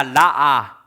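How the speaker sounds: 라아 said as ra-a, long open a's; Hebrew word demo. Shown as a demonstration. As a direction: model 라아 for the class to repeat. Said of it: "히브리어로 따라합니다".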